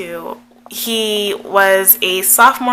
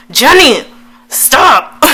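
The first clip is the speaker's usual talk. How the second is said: loud, higher-pitched reprimand; mock scolding; 6 semitones higher, 8 dB louder, much slower than usual. Vlogger telling her past self off, playful scolding. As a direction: call yourself out sharply by name.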